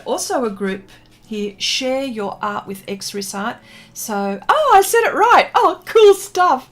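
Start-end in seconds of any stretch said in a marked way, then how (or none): 4.5-6.7 loud, high-pitched exclamation; real delighted relief. Real, not acted. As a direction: burst out in triumph, much higher and louder. Delighted at getting name right.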